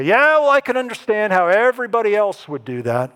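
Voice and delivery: acted-out smug excuse; self-assured dismissal. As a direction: concede it breezily, putting it all on others. Voices easy self-righteous excuse.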